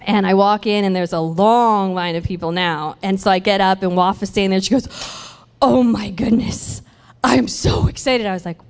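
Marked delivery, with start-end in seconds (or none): 5.6-8.2 drawn-out, gushing retold thrill; imitating excitement. Deli worker greeting her the next day, acted excitement. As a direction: act out her thrill, stretching the words. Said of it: "So she was so excited".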